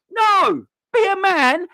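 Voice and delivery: high, loud outburst on "no!"; furious shout. Real, not acted. Ranting at passive managers, furious shout. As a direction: bark it at them.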